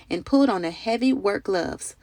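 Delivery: ordinary delivery, nothing marked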